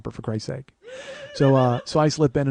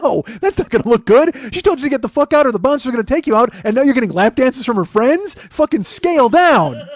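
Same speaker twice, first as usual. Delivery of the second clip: loud, high exasperated rant; scolding himself; 10 semitones higher, 8 dB louder than usual. Acted. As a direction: yell sense into yourself, slowing on the last words. Scolds himself in acted inner argument.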